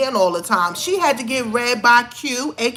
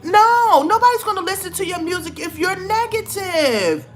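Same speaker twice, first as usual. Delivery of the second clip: exasperated protest; 8 semitones higher than usual. Real, not acted. Ranting at Tamar over her music, exasperated and high. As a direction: throw your hands up in disbelief.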